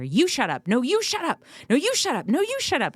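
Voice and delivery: put-on high-pitched childish squabble; siblings bickering. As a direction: mimic kids trading insults. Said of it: "all of a sudden you hear".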